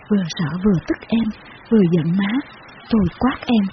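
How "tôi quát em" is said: ordinary delivery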